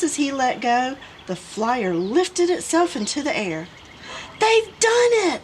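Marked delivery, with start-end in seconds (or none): 4.4-5.4 very high, loud shout; excited shout. A narrator's reading. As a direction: shout in triumph. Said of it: "shouted Mister Mockingbird".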